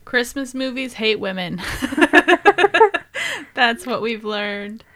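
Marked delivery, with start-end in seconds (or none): 1.7-3.5 high, loud burst of laughter; real laugh. Real, not acted. Cracking up at own joke that Christmas movies hate women, loud high laugh.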